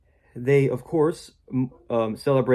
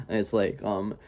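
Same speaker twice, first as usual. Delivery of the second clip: ordinary talk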